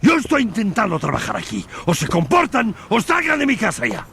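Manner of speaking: high, loud commanding yell; furious shout. Acted. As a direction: shout everyone down. Hector ends family quarrel.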